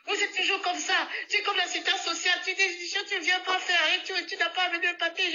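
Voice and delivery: put-on high, indignant complaining old woman; high old woman ranting; ranting old lady. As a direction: rant like a cross old lady. Old woman scolds speaker.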